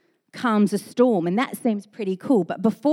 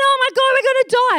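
higher, louder, fast panicked wail; mock panic; 7 dB louder, faster than usual. Disciples fear drowning, fast hammed-up panicked wail. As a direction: wail it fast in fake terror.

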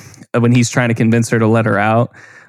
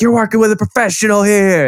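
much higher, cocky showman voice; Beetlejuice impression; 10 semitones higher than usual. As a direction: play the smug con man bragging. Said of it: "he goes up to the tree and he's like".

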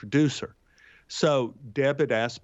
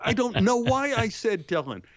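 caught out; 9 semitones higher than usual. Retold or imitated. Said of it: "And I'm going like".

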